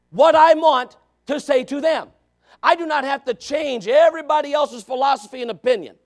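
loud, high, emphatic preaching; forceful preaching. Real, not acted. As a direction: drive each phrase home from the pulpit.

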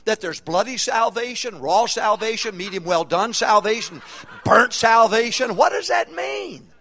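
high, loud, scornful preaching; preaching shout. Real, not acted. Preacher ridiculing the phrase really saved, shouting. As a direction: hammer the question at the crowd.

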